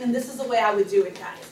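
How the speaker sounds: ordinary talk, nothing marked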